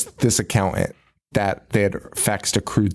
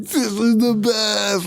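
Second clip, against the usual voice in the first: high, drawn-out gushing; long, high-pitched whoop of delight; mock party elation; 9 semitones higher, slower than usual. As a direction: imitate an overjoyed partygoer, stretching every word. Voicing Larry at the stripper party.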